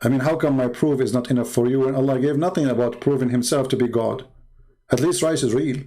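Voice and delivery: ordinary talk, nothing marked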